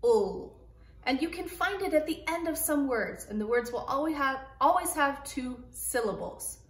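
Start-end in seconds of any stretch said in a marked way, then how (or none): 0.0-0.6 ul said alone as a short clear syllable; isolated ul sound, louder. Shown as a demonstration. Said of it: "The L E says the sound".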